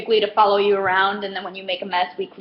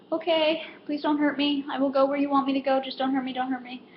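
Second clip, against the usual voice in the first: higher, meek little voice, softening; acted timid surrender, trailing softer; mock fearful pleading; 4 semitones higher, 4 dB quieter than usual. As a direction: plead as a cowed cashier, hammed-up. Cashier plays cowed by angry customer.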